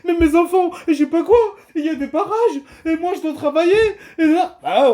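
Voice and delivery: high, tearful mock protest; mock whining. Vlogger mimicking French people stuck behind farmers' roadblocks, mock whining. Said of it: "Après, vous allez pleurer".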